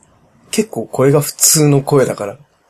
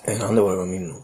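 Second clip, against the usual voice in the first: dropped low and soft, a bored coworker; low everyday voice; 5 semitones lower, 8 dB quieter, faster than usual. Shown as a demonstration. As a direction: answer like you can't be bothered, low and quiet. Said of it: "普段はもっと低い声だよ。".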